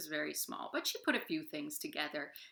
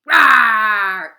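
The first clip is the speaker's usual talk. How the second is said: big shouted lion roar; loud roar; 4 semitones higher, 23 dB louder than usual. Shown as a demonstration. Storyteller leading children in a lion roar, huge shout. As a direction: roar with the kids at full force. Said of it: "Do you guys want to roar with me".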